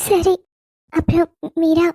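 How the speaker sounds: ordinary delivery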